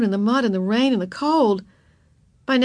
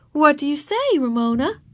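higher, coaxing question; prompting teacher; 8 semitones higher than usual. A narrator's reading. Teacher prompting girl to say thanks, coaxing. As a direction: nudge a child toward good manners.

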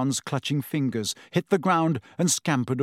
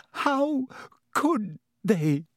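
choked up; 9 semitones higher, much slower than usual. Acted. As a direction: voice catching, high and strained. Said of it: "she choked".

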